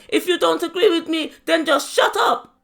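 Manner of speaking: high, loud mock scream, slowing on "shut up"; imitated screaming. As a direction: screech it as the mother who won't stop. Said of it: "And she kept screaming".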